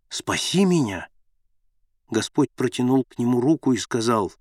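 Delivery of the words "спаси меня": ordinary delivery, nothing marked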